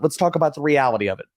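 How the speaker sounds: usual speaking voice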